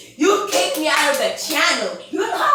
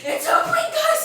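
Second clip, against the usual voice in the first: excited high cry. Real, not acted. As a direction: pitch shoots way up on "It's a prank". Caught mid-prank, announcing to viewers.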